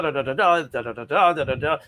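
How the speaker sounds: lightly mocked rote reading in a da-da rhythm; mock rhythm of words read with emphasis but no real life; sing-song emphasis. Shown as a demonstration. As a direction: rattle off the da-das like a stiff recitation. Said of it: "put some emphasis on the words and da".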